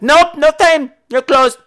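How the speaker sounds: high, loud, brusque refusal; caricatured high-pitched shout; mock gym owner. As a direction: imitate her turning a customer away, high and loud. Imitates gym owner turning him away.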